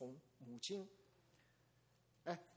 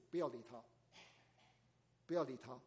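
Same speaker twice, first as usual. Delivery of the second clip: ordinary talk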